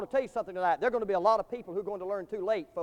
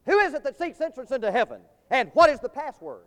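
higher, ringing pulpit challenge; heaven's gatekeeper challenge; 5 semitones higher, 7 dB louder than usual. Acted. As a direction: demand it like the guard at heaven's gates. Heaven's gatekeeper challenges the first man.

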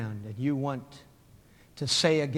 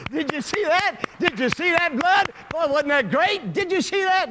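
very high, loud excited yelling; roaring crowd; 14 semitones higher, 9 dB louder than usual. Acted. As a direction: yell like a thrilled arena spectator. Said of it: "the people laugh and roar".

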